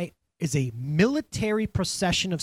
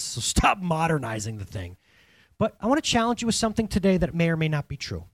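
normal talking voice

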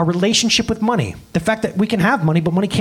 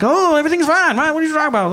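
evasive guy; 11 semitones higher, faster than usual. Retold or imitated. A boyfriend dodges the relationship talk, high breezy denial. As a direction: brush it off like nothing's wrong.

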